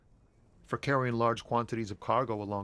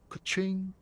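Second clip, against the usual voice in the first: high sung-out ka-ching; cash register ka-ching; 8 semitones higher than usual. Acted. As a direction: chime it like a till. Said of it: "He makes a ka-ching sound".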